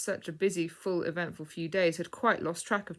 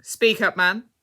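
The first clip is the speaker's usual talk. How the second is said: loud command; 5 semitones higher, 13 dB louder, slower than usual. A narrator's reading. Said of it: "said Mister Discover the Truth".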